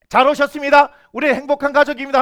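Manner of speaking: full-voiced cheery welcome; acted happy greeting. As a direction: greet them as an openly happy family. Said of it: "누가 봐도 행복해야 되는데".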